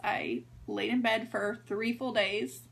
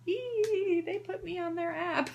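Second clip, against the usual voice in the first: high, squealing little burst of glee; geeky excited squeal; 8 semitones higher, slower than usual. Retold or imitated. To viewers, mocking her own geeky excitement, high squeal. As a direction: squeal it like a delighted nerd. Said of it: "like, nerding out".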